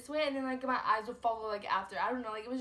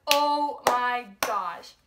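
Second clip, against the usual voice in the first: a drawn-out, much louder "oh my gosh"; shocked gasp; 9 dB louder, much slower than usual. Retold or imitated. Seeing a gunman from behind the gate, loud slow shock. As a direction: stretch it out slowly, loud with shock. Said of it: "and I'm like".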